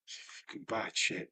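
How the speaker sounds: breathless whisper under the breath; whispered aside. Real, not acted. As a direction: drop to a whisper, a secret aside. Aside about the dog.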